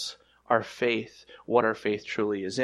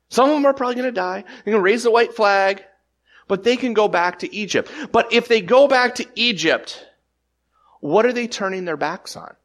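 louder, higher, pressing the point home; preaching at full force; 9 semitones higher, 6 dB louder than usual. Acted. Pastor to congregation about going back to Egypt, building preach. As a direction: drive it at the congregation like a revival preacher.

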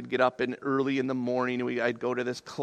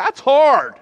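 high, loud outburst; mock-aggrieved exclamation; loud protest; 10 semitones higher, 13 dB louder than usual. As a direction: burst out in exasperation. Protests at the verse's demand.

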